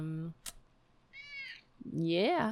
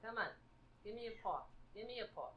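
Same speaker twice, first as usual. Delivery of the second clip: soft coaxing; 2 semitones higher, 20 dB quieter, much faster than usual. Real, not acted. Coaxing the cat.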